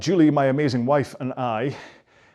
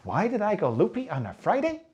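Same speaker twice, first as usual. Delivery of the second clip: put-on high, sing-song question to young viewers; Dora the Explorer; 7 semitones higher than usual. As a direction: ask it like a children's show host. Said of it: "It's like Dora the Explorer".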